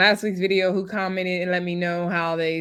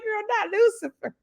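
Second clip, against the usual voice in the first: high, airy mock disbelief; 13 semitones higher than usual. Recapper quoting her reaction to Bria, high mock disbelief. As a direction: squeal it like a scandalised friend. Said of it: "I was like".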